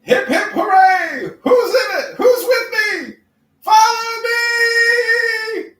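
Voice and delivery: high, loud battle cry; rallying shout. Pretend.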